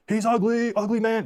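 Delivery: put-on high taunting child's yell; kid screaming. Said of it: "This kid is just screaming".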